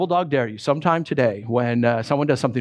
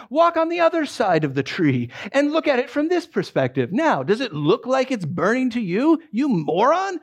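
acted condescension; 5 semitones higher than usual. Correcting a friend about the tree, mock condescension. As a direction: spell it out for a fool, hammed-up.

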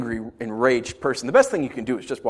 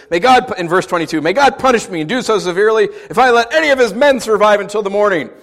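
David's oath; 7 semitones higher, 8 dB louder than usual. Retold or imitated. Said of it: "he says".

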